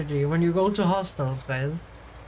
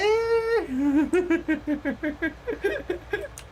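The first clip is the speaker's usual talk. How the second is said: high, drawn-out giggling; long laugh; 7 semitones higher than usual. Real, not acted. Laughing about confused Americans in Europe. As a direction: let the laughter roll on.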